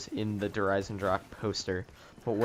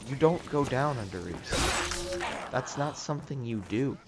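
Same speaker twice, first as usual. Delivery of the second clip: ordinary talk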